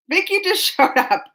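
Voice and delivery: laughing. Real, not acted. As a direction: laugh in the middle.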